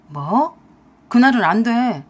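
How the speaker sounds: loud, startled husband; startled refusal. A narrator's reading. Husband refuses his wife the party date, startled objection. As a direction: burst out loudly in protest.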